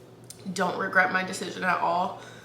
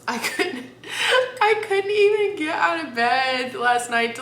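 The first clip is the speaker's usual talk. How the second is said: laughing; 7 semitones higher, 4 dB louder than usual. Real, not acted. Laughing about falling asleep.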